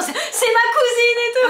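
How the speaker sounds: mock embarrassed kid.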